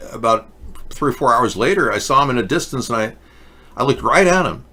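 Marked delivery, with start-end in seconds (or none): none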